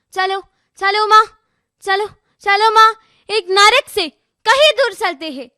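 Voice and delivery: high, insistent begging in verse; helpless plea. Acted. Unborn daughter begging mother for a chance, high pleading. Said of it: "पर एक babes आवाज आई".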